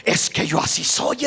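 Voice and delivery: low, whispered shrug of an excuse. Retold or imitated. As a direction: breathe it out like a believer excusing himself. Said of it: "muchos hermanos aún dicen".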